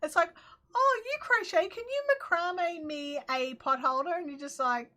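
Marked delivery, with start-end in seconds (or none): 0.7-4.1 high, loud start easing down as it goes; mock-keen favour-seeker, opening high; mock gushing request. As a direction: lightly imitate an eager asker. Voicing people asking crafty favours.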